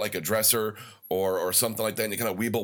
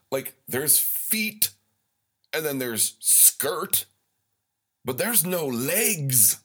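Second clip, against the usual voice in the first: slow dramatic buildup; 4 semitones higher, much slower than usual. Retold or imitated.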